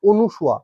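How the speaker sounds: অনুসুয়া said with "nu", onu-shua; common mispronunciation. Shown as a demonstration. Teacher showing students the common error, mispronounced with "nu". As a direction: say অনুসুয়া with an u after the n. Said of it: "আমরা উচ্চারণ কিন্তু অনেকেই করি".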